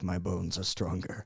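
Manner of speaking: low, slow, soft boast; understated deep-voiced bragging; smug retort. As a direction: deliver it like an unshakable strongman. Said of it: "I would just be like".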